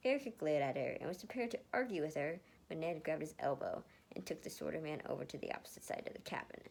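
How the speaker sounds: plain reading voice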